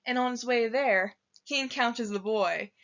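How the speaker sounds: usual speaking voice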